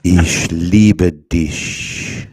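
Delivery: very slow, loud German, word by word; slow language demonstration. Shown as a demonstration. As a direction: model the German phrase for a learner. Teaching a friend German phrase.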